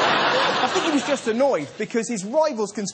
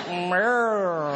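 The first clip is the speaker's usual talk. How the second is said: camp drawn-out meow, lower pitch; Kenneth Williams voice; 5 semitones lower than usual. Retold or imitated. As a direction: purr it like a camp comic. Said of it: "he would go".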